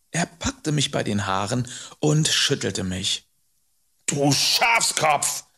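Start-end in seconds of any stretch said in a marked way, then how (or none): 4.0-5.4 slow, raised, scornful scolding; angry insult. Acted. Man shakes boy by the hair, scolding angrily. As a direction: draw the insult out in anger.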